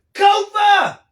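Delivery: loud, high call across the room; shouted greeting. Real, not acted. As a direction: call the name out joyfully. Greeting a viewer by calling out.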